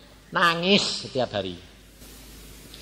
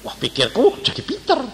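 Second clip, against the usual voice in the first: ordinary delivery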